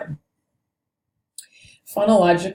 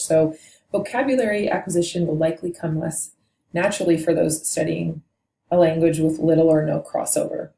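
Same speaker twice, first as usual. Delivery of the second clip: usual speaking voice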